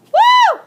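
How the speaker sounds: high loud whoop after a long sentence; triumphant whoop. Real, not acted. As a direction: let out a big celebratory woo. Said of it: "I am out of breath".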